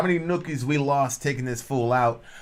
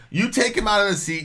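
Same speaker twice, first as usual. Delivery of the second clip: usual speaking voice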